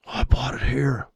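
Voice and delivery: breathy mock whisper of a trader's boast; whispered quote. Trader quoting a buyer's secret boast, whispered. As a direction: hush it like a secret buy.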